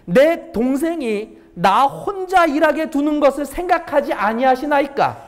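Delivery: a touch of indignant complaint, slow; Martha's complaint. Acted. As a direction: mildly voice Martha's grievance. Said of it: "예수께 나아가 가로되".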